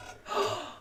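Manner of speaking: a sharp hammed-up gasp; theatrical gasp; mock gasp.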